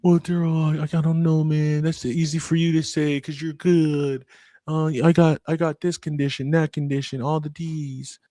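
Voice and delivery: high, whiny mock client making excuses; imitated doubting clients. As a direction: play a reluctant client pitched well above your voice. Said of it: "when they tell me".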